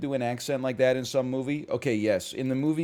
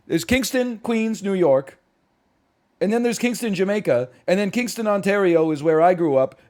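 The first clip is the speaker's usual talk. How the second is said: louder and higher, an exasperated rant; mock outrage; 7 semitones higher, 6 dB louder than usual. Real, not acted. Streamer ranting to chat about Kingston names, exasperated loud. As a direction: vent at the English language.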